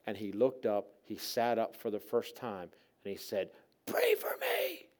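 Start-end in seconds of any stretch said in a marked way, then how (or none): 3.9-4.9 slow, harsh whispered croak; raspy, voiceless begging; sick man's rasp. Acted. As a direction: force out a plea after months of silence. Mute sick man suddenly speaks.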